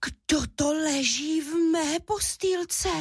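high, slow bear cub voice; gasp, then squeak it out in amazement; astonished squeak. A narrator's reading. Little bear cub finding someone in his bed, astonished squeak. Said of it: "vypískl udiveně malý medvídek Míša".

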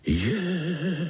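high, drawn-out wavering cry; a mildly frightened little yelp; startled chorus. A narrator's reading. Little spiders hear of fire, startled. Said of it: "vylekali se pavoučci sborem".

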